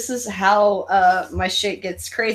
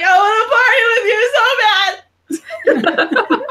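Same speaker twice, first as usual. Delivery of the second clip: high, loud, slow wailing sob; hammed-up sobbing plea; mock ugly cry; 13 semitones higher, 7 dB louder, slower than usual. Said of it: "you do an ugly cry too".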